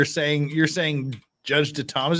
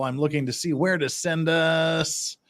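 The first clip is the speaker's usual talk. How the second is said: ordinary delivery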